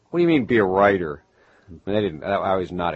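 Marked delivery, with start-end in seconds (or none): 0.1-1.2 mock disbelief, louder; incredulous neighbours. Townsfolk to the boy who wants to write, incredulous. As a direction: throw it back as a scoffing question. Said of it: "they were going".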